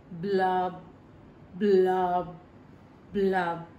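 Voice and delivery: deep, slow bubbling blubs; low fish bubbles. A narrator's reading. Fish blowing bubbles after his refrain, low blubs.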